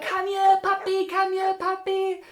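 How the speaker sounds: high, loud, drawn-out coaxing call; retold calling a puppy. Friend chasing a runaway dog, high excited calling. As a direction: imitate a friend cooing after a dog.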